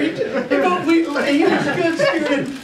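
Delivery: high, slow words squeezed through laughing; cracking up while talking; real laughter. Real, not acted. Host recalling the Lenore auction, laughing hard.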